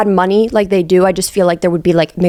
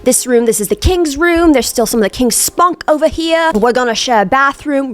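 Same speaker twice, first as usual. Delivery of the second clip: much higher, breezy host voice; posh host Felix; 6 semitones higher than usual. Retold or imitated. Felix welcoming Oliver into the castle, breezy high voice. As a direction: sweep him in like a lord of the manor. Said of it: "Felix is walking around the house like".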